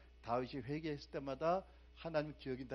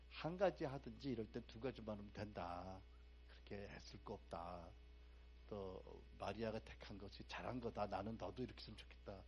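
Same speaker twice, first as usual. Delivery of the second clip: softer, calm and kind; gentle reply; 5 semitones lower, 10 dB quieter than usual. Retold or imitated. Preacher voicing God's gentle answer to Martha, soft and calm. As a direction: answer her softly and kindly. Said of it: "놀란 반응을 보이시잖아요".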